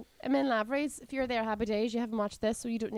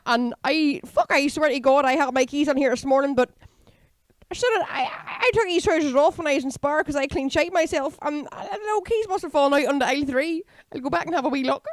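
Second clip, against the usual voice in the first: put-on louder, higher, flustered Ballymena accent; rattled Ballymena woman, louder; 4 semitones higher, 5 dB louder than usual. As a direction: panic over lost keys in broad Ballymena. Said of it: "a confused Ballymena woman".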